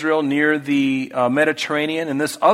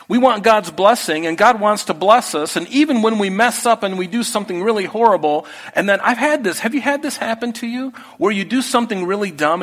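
impassioned preaching; 7 semitones higher, 3 dB louder than usual. Acted. Preaching climax.

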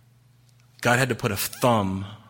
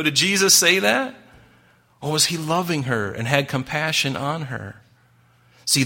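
ordinary talk, nothing marked

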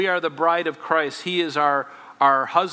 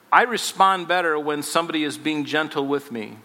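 ordinary talk

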